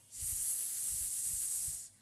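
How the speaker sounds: sustained snake-like hiss; long S hiss. Shown as a demonstration. Teacher demonstrates the S sound, long hiss. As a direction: hold a long voiceless sss. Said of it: "S sound is".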